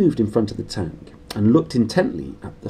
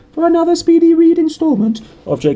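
upbeat sign-off; 10 dB louder than usual. Real, not acted. Cheery sign-off to listeners.

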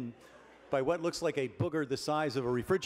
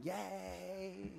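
mock crowd cheer; 4 semitones higher, 10 dB quieter than usual. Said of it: "Then you hear some people cheering".

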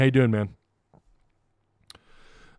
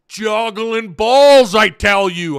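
put-on loud, high, drawn-out Italian accent; 7 semitones higher, 6 dB louder, much slower than usual. Streamer imitates the new coach, exuberant Italian accent. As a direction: exclaim it slowly as a hammed-up Italian. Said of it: "I love doing the Italian accent".

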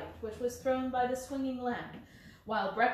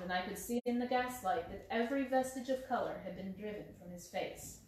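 ordinary delivery

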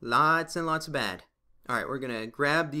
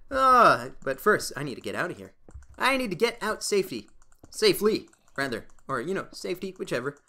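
usual speaking voice

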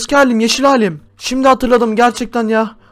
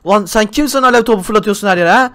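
normal talking voice

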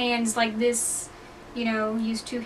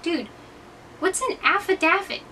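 baffled question; 6 semitones higher than usual. Retold or imitated. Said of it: "I was like".

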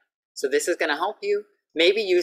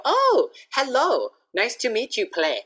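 cheerful greeting; 10 semitones higher, 3 dB louder than usual. Retold or imitated. Teacher retelling greeting a student she thought was Ple. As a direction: greet the new student, overly sweet. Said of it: "That's what I thought their name is".

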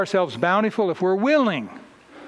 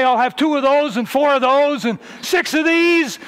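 mock fast-food order; 7 semitones higher, 6 dB louder than usual.

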